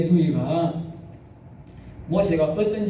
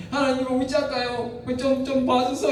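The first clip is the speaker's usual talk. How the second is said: higher, pleading Moses; 6 semitones higher than usual. Retold or imitated. Moses pleading with God for his sister, mildly voiced. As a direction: beg God for mercy, mildly. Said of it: "모세가 할 수 없이 하나님 앞에 가가지고".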